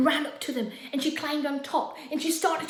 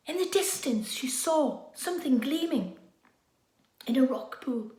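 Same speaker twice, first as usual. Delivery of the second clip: ordinary delivery, nothing marked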